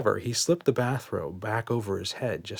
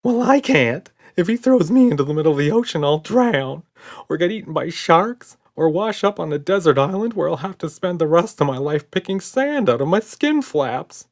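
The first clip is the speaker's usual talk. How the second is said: much higher and louder rush of panic; panicking monster; 8 semitones higher, 7 dB louder than usual. A narrator's reading. Red panics to Buddy, high and loud. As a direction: babble in terror.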